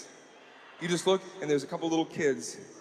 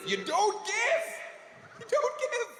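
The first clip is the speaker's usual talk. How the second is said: outraged yell; slower than usual. Acted. Comic rants at stingy viewers.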